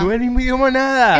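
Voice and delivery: high, loud protest; loud objection. Real, not acted. Co-host disputing that the languages are the same, loud protest. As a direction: object at the top of the voice.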